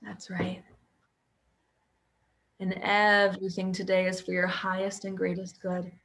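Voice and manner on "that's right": soft unvoiced reassuring whisper. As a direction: breathe it out as a whisper.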